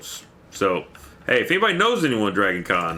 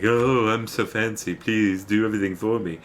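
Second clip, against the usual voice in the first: mock lazy diva; 3 semitones higher than usual. Streamer mocking lazy content creators who want everything done, put-on diva voice. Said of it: "lazy content creators that's gonna show up and just be like".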